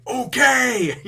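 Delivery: very loud, high, drawn-out holler; hammed-up rallying yell; excited shout. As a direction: imitate a hero bellowing, raring to go. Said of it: "and he's excited".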